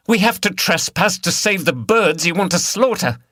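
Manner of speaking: loud, high protest; angry. A narrator's reading. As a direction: defend the cause fiercely. Liz protests.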